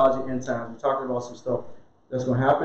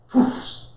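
breathy poof. Acted. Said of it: "sound of things burning away".

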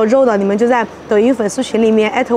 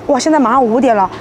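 ordinary talk, nothing marked

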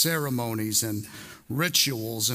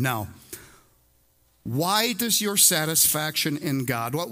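usual speaking voice

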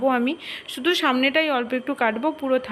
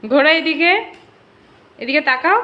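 calling out; 4 semitones higher, 6 dB louder than usual. Real, not acted. Mother directing child loudly.

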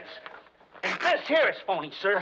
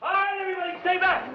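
shouting a warning; 12 semitones higher, 4 dB louder than usual. Acted. Holds a gun, warns crowd.

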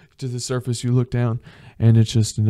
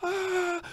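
mock panic scream; 7 dB quieter than usual. Imagined crash scream.

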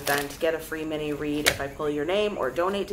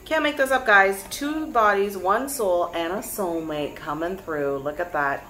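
normal talking voice

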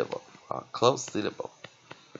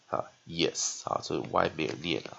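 ordinary talk

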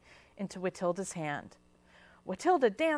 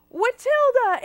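much higher and louder, furious; thundering shout; 14 semitones higher, 10 dB louder, slower than usual. A narrator's reading. Aunt Bort furious at Watilda's web hairdo, thundering shout. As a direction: roar her name across the salon. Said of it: "Aunt Bort thundered".

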